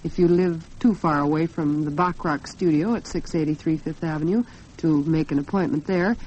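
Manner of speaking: ordinary talk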